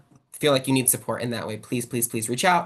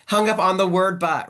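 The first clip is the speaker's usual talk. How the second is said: louder, higher restatement landing on "but"; emphatic self-correction; 5 semitones higher, 7 dB louder than usual. Real, not acted. Speaker repairs an accidental pun, stressing the word "but". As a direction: set "the word but" out clearly and firmly. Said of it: "That's not what I meant to say".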